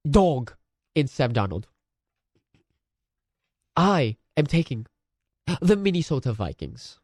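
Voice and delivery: posh English pundit, mock serious; lightly put-on British broadcaster; English presenter accent. Hosting the picks show in a mock English accent. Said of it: "I can't do an English accent".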